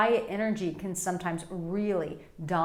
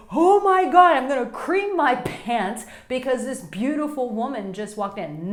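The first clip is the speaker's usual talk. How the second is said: hammed-up squeal, much higher and louder; mock a man losing it over a woman; over-the-top desperate gush, voice shooting up; desperate excitement; 5 semitones higher, 6 dB louder than usual. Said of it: "that desperate energy".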